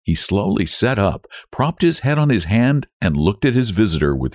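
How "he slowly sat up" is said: usual speaking voice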